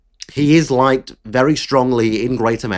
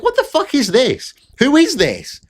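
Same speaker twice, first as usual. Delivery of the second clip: much higher, incredulous outburst; mock disbelief; 12 semitones higher than usual. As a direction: play stunned disbelief at a bad answer. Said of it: "I'm like".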